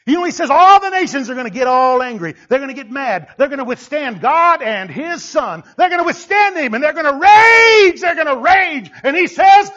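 loud, high, building to a shout; fiery preaching. Acted. Preacher warning of nations raging against God, fiery building shout. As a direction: preach the nations' rage at full force.

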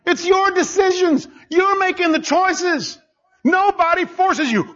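high, slow, hammering each point; preaching at full force. Acted. Preacher insisting to the church that choices are theirs, full force. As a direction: drive it home to the congregation.

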